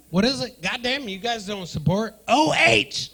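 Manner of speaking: usual speaking voice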